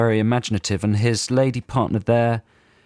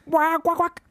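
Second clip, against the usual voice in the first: synth imitation. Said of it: "I did the synthy bit".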